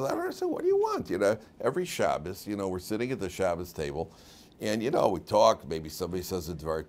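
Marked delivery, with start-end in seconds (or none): 0.0-1.1 put-on much higher, puzzled little boy voice; innocent boy's protest. Boy answers scolding principal, high and bewildered. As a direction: play the kid who doesn't get what he did wrong. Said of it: "He says".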